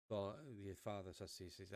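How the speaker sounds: ordinary talk, nothing marked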